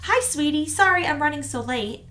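a hint of a busy mom calling; lightly voiced mother on the phone. A narrator's reading.

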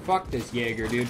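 ordinary delivery

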